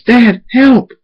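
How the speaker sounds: high, loud cry for help; screamed plea. A narrator's reading. Scootaloo tackled by wolves, screaming to dad. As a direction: scream for your father to rescue you. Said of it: "Scooter screams".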